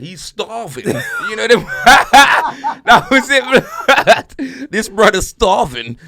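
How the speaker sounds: loud laughter spilling through the words; laughing hard. Real, not acted. Friend retelling a tweet to his mate, cracking up loudly.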